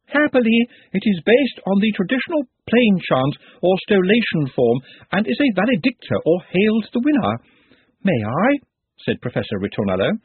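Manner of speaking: high, eager music professor; lightly voiced excitable professor; thin reedy voice. Ritornello explaining his chant.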